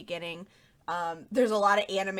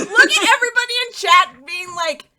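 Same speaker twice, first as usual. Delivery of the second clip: laughing shout; 9 semitones higher, 12 dB louder, slower than usual. Real, not acted. Mocking chat, laughing loudly.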